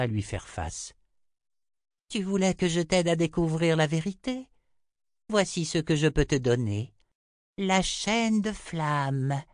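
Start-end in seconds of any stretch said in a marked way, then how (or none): 2.1-9.4 high seer voice, slowing on the last phrase; Chota, lofty. A narrator's reading. Chota hands Richard the promised answer, high solemn voice. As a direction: pitch it high and stretch the final words.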